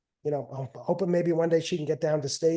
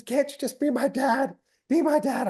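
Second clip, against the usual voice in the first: higher, mock tearful plea; imitating pleading daughter; 9 semitones higher than usual. Daughter cutting off her coaching dad, mock-tearful plea. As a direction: beg like a hurt kid.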